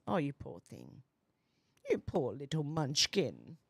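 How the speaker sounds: low, soft fake sympathy; poor-baby mock consoling; mock pity. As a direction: pity them mockingly, voice dropped low. Mock pity for an upset commenter.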